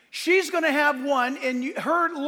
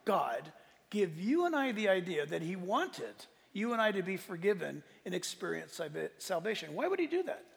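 sarcastic; 5 semitones lower, 9 dB quieter than usual. Pretend. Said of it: "I'm being sarcastic right now".

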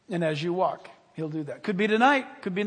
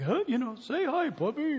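lightly voiced man talking to his puppy; raised pitch, loosely mimicked pet talk; imitated dog owner; 6 semitones higher than usual. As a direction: coo a little at a puppy. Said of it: "he said something like".

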